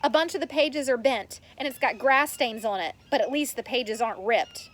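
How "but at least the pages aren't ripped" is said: usual speaking voice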